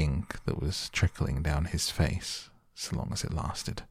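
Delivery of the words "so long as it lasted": ordinary delivery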